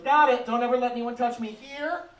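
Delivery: put-on kid repeating rules. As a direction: recite the rule back like a dutiful little girl. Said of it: "She goes".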